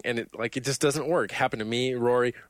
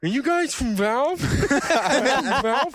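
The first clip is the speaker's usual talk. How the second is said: put-on much higher, louder goofy kid voice; Ralphie voice; 12 semitones higher, 3 dB louder, much slower than usual. Gamer in Ralphie voice asking the developers if they're from Valve. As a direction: ask it like an excited little boy. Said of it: "the Ralphie voice".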